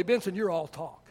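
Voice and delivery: low, soft retelling of the coach's words; quietly quoted coach. Retold or imitated. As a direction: say it softly, just reporting it. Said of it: "my junior high football coach told me".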